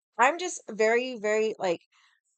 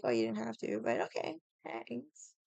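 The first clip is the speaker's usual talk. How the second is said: unbothered thanks; 9 dB quieter than usual. Retold or imitated. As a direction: shrug it off, nearly under your breath. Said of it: "I wasn't mad".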